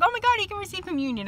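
high, quick retold exclamation; excited self-quote. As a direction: retell it as an eager, high-pitched gasp of news. Acting out own surprised reaction.

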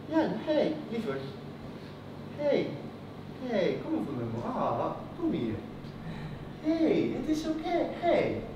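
soft, soothing motherly voice; tender comforting. Acted. Mother drawing her child in for comfort, tender soothing. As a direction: gently console an upset child.

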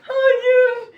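high, loud panicked cry; desperate wailing no. Real, not acted. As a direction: wail it out in despair. Panicked protest at the drink.

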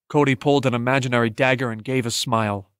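ordinary delivery, nothing marked

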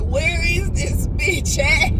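high, loud, slow mock-annoyed friend; imitating Natalie. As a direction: play the impatient friend stuck at the airport, drawn out. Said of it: "at the airport like".

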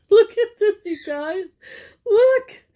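high, loud gasping delight; excited gasp. Real, not acted. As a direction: gasp and call everyone to look. Gasps at a kitchen sign.

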